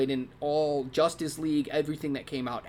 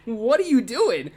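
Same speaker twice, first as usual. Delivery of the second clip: baffled; 7 semitones higher, 7 dB louder than usual. Retold or imitated. Baffled reaction to Conroy.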